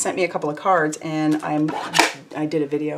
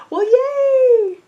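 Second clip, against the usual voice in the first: very high, louder squealed yay; squealing well yay for a viewer; excited cheer; 7 dB louder than usual. Real, not acted. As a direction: cheer like a fan.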